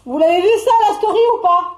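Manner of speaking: worked-up, high-pitched outburst; loud challenge. Real, not acted. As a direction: call it out indignantly to the crowd. Challenges viewers about the story.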